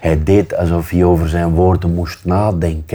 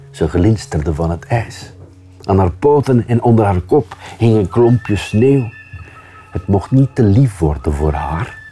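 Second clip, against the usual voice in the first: ordinary delivery, nothing marked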